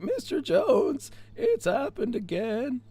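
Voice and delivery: put-on high, slow cracking teenage voice; voice-cracking spotty teenager, slow and high; Simpsons teen impression. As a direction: squeak it out like a gawky burger-flipping teen. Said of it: "you know that spotty teenager in, in The Simpsons".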